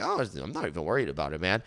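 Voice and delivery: quick, soft, unbothered brush-off; casual brush-off. Shown as a demonstration. Shrugging off an angry friend, quick and soft. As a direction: shrug it off lightly and fast. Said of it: "That's what I always do".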